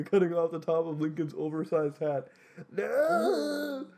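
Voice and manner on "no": high, drawn-out mock-anguished "No!"; dramatic cry. Defeated Lincoln falls to his knees, melodramatic cry. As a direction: wail it like a melodramatic death scene. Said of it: "Lincoln cried, falling to his knees".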